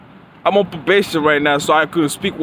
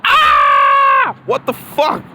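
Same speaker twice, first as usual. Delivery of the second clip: sudden high yell, a fake freak-out; mock shout; 6 semitones higher, 5 dB louder, much slower than usual. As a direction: blurt it out like a startled outburst. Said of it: "and then be like".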